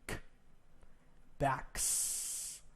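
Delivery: "Backs" whispered, ending in a hissed s; breathy "Backs" with a voiceless s. Shown as a demonstration. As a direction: show the unvoiced "k" then "Backs". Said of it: "Say with the K sound".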